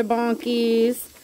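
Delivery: usual speaking voice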